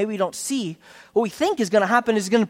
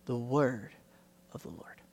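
slow, hushed quote; 6 semitones lower, 7 dB quieter than usual. Shown as a demonstration. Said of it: "this little prepositional phrase".

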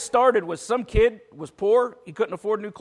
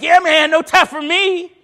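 higher, louder, pleased with himself; "Yeah, man, no tie for me" tossed out higher and louder with swagger; cool guy bragging; 10 semitones higher, 10 dB louder than usual. Retold or imitated. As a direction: brag it like a cool guy showing off. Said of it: "the other guy be like".

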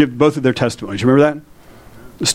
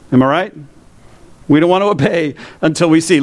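normal talking voice

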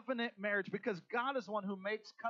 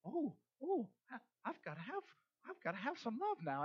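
lovestruck swoon; 5 semitones higher, 3 dB quieter than usual. Retold or imitated. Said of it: "my eyes are like".

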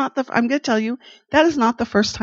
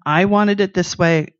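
ordinary talk, nothing marked

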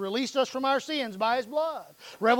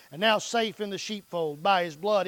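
normal talking voice

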